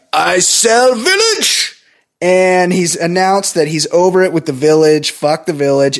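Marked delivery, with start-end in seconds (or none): 0.1-1.8 slow, broken-English mock villain; mock Sato. Podcaster voicing Sato announcing the sale, slow mock accent. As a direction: drag out Sato's gloating line. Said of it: "And he's like".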